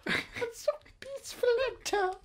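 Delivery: hushed high-pitched Icelandic voice. Acted. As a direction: let it float up high and small. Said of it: "Very close to [name]".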